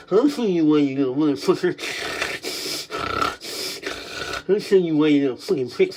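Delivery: low, menacing man hurling insults; abusive male voice. Acted. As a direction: threaten them as the other man. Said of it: "I'm doing the male voice".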